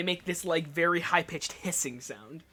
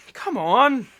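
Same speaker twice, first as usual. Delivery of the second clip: Donovan impatient; 6 semitones higher, 8 dB louder than usual. Acted. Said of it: "just looks and goes".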